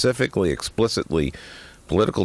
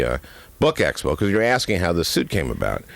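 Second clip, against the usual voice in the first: ordinary talk, nothing marked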